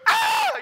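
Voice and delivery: very high, loud mock scream; hammed-up shriek of fright; terrified scream. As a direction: scream like a panicked rider. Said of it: "you're screaming".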